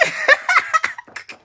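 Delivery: high-pitched giggle; squealing laughter; cracking up high. Real, not acted. Laughing at own dirty joke.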